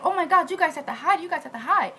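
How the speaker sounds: high, urgent retold warning; mock panic. Employer warns her maids to hide, acted panic.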